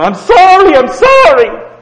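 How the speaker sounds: loud high cry, falling on sorry; screaming sorry. Retold or imitated. Preacher imitating sinners facing consequences, screaming. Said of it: "we scream".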